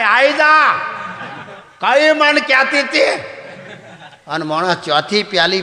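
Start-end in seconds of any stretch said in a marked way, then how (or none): 0.0-3.1 put-on high, loud drunken bravado, slowing into a laugh; a drunk who thinks he is a tiger; drunk tiger roar. Storyteller voicing drunk Mona as a tiger, high and loud.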